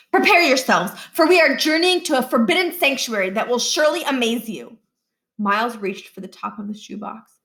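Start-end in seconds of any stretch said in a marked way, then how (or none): 0.1-4.8 louder, higher theatrical announcement; boy presenting his diorama like a showman; grand showman. Acted. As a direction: sweep the class into a mystery. Niles's showman build-up.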